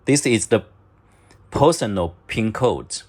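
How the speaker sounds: ordinary talk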